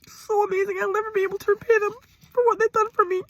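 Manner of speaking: high, breaking voice, slowing on home; sobbing gratitude toward her father; crying. Real, not acted. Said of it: "get through a video without tears".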